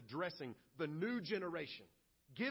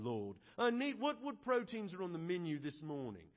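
picky complainer; 4 semitones lower than usual. Retold or imitated. Israelites grumbling to the Lord about manna, lower fussy snob voice. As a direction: lower put-on voice, fussy diner ordering. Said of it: "They respond just like Nathan responds".

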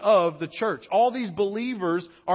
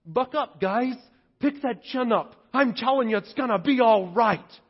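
mock cheery pep talk in a higher voice; hammed-up higher-pitched cheerleader; Barnabas; 4 semitones higher than usual. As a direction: chirp empty encouragement at the guys. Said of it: "Barnabas didn't just walk around going".

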